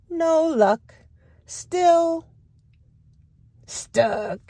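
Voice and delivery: high then breathy sigh of stuck; stuck duck refrain. Acted.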